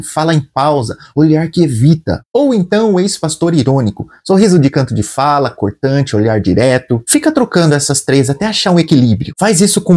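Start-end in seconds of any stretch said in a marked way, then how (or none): none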